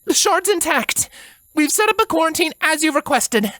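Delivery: high, steady soldier voice; soldier's report. A narrator's reading. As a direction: report it crisply to your commander. Soldier continues report to princess.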